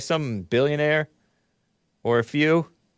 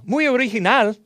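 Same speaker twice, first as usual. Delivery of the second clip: put-on much higher Spanish-accented flourish; 10 semitones higher than usual. Host airing his college Spanish, Spanish accent. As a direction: show off classroom Spanish. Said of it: "I learned that from Pasadena City College Spanish teacher".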